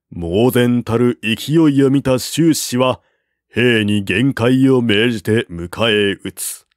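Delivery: ordinary delivery, nothing marked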